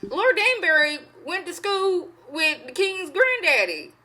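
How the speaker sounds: high, rising, insistent daughter arguing; pleading daughter voice. Retold or imitated. Daughter argues with her mother, high pleading voice. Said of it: "she was like".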